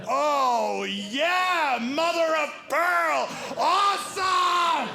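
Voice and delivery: high, loud, slow gushing exclamations; overjoyed exclaim. Retold or imitated. Comic gushes to security man, high and loud. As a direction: gush with giddy joy. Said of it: "I'm like".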